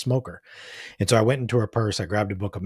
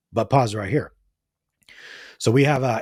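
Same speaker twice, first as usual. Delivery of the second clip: ordinary talk, nothing marked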